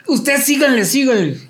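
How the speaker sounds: high, falling wail; imitated pained high voice; mock tearful urging. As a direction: wave the band on while pretending to cry. Injured groom urging band onward.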